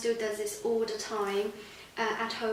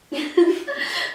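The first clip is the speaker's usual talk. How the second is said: high burst of laughter; loud laugh; 7 semitones higher, 10 dB louder than usual. Real, not acted. Laughs at Bob's uncle.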